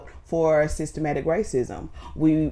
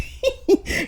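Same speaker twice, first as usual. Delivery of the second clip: burst of laughter. Real, not acted. Laughing while quoting Kobe.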